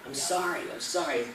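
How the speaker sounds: "sorry" as SAH-ree, open first vowel; American "sorry". Shown as a demonstration. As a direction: demonstrate "sorry" with a lazy American ah. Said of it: "They say S A R R Y".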